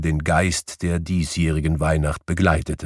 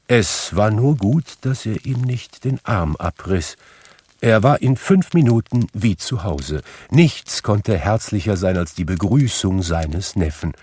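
ordinary delivery

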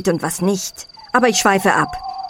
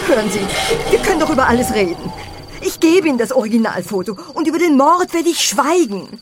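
high, frantic begging; desperate pleading; 8 semitones higher than usual. Acted. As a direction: plead for your life in panic. Victim begs for her life.

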